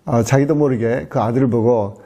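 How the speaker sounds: ordinary delivery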